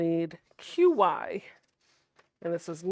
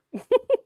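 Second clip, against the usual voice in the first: short, high, loud laugh; 11 dB louder than usual. Real, not acted. Quilter amused at their own excited demo, laughing. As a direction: burst out in a quick laugh.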